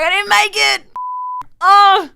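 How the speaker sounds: high, loud hammed-up cry of defeat; mock despairing wail. Retelling waiting for the WWE call, loud mock wail. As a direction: throw your head back and wail.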